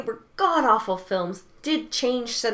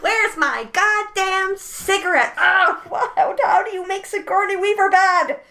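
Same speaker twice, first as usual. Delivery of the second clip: Galaxy Quest quote voice; 11 semitones higher, 8 dB louder than usual. Retold or imitated. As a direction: bellow it like a hammy actor. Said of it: "Galaxy Quest".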